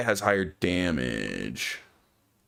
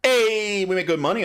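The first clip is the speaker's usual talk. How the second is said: excited cheer; 10 semitones higher, 4 dB louder than usual. Real, not acted. Happy about the sale.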